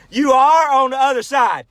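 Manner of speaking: high, loud punchline holler; hollering back. Acted. Other blonde answering across the lake, hollering back. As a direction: shout it back across the water. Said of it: "hollered to the other one".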